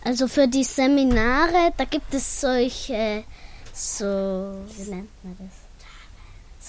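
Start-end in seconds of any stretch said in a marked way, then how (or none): 3.7-6.7 slow hushed murmur, groping for a term; whispered aside. Real, not acted. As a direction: whisper to yourself while hunting for the word. Searching for a word, aside.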